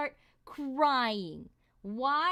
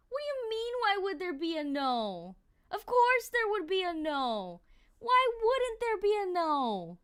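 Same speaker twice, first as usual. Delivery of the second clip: higher-pitched playful indignation at chat; rising, exasperated protest, half joking; mock outrage; 7 semitones higher than usual. Real, not acted. Streamer mock-outraged at chat poll.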